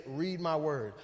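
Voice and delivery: low, soft inner voice of God; lightly voiced low, quiet prompting. God prompting a tired believer at night, low and soft. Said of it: "you hear a voice that says".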